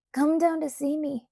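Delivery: high eager plea; begging. A narrator's reading. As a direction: beg her to come. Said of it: "he begged".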